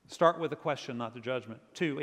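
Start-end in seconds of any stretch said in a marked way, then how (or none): none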